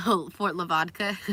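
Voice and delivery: loud, slow laughing exclamation; tickled and loud; amused outburst. Real, not acted. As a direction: burst out, cracking up. Laughs at own slip.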